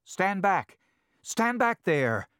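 high, loud commanding shout. Acted. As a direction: bark the order to stand back. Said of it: "commanded Dominic".

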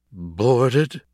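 startled echo of bad news; shocked exclamation. A narrator's reading. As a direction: exclaim it as an appalled question. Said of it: "Chester exclaimed".

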